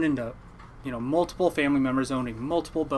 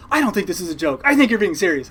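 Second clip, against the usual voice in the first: higher, louder suspicious retort; retold insistence; 7 semitones higher, 9 dB louder than usual. Uncle doubting the prank confession, loudly insistent. Retells uncle rejecting the joke claim.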